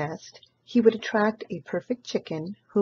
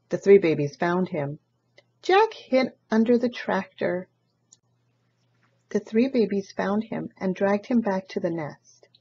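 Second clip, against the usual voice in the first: ordinary delivery, nothing marked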